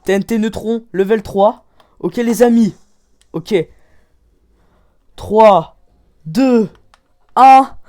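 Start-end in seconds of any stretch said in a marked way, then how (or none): 5.2-7.8 countdown shout. Real, not acted. Player counts viewers down to the big blast, loud building countdown. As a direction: punch out each count loudly, building.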